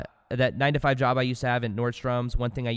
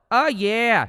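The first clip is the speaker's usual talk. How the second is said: very high, loud "Oh, yeah"; hyped catchphrase; 9 semitones higher, 6 dB louder than usual. Shown as a demonstration. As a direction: lighten up and belt it. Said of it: "Just like that".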